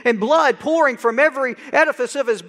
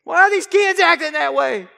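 put-on loud, high shout at God over the kids; exasperated shout; 6 semitones higher, 4 dB louder than usual. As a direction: yell it half laughing, fed up. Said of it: "and I say".

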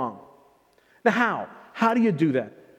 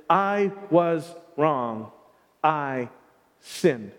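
slow, sinking lower to a hushed sinned; humble confession; 4 semitones lower, much slower than usual. Shown as a demonstration. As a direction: confess it slowly, letting the voice drop. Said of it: "Repentance is".

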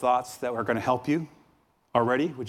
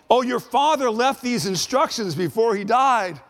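mock pleading; 8 semitones higher, 3 dB louder than usual. Brothers' lying message, mockingly voiced.

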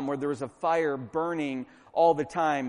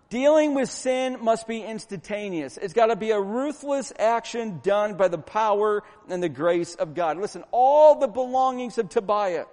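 higher, driving sermon delivery; forceful preaching; 5 semitones higher, 5 dB louder than usual. Real, not acted. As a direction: preach it hard, punching the key words.

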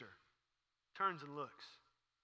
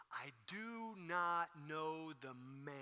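very slow, drawn-out, softer denial; deliberate denial; 5 dB quieter, much slower than usual. Acted. Peter denies Jesus before rooster crows, slow drawn-out denial. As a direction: stretch every word out, "man" last. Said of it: "so Peter says these words".

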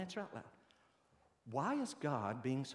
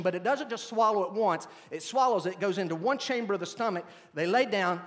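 louder, higher preaching with punched words; 8 semitones higher, 8 dB louder than usual. Acted. Pastor explaining clean animals to his church, preaching hard. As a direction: drive each point home to the congregation.

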